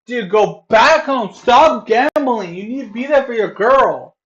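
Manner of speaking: loud, high scolding rant; angry lecture. Real, not acted. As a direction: tear into him like a big brother. Lecturing chatter angrily.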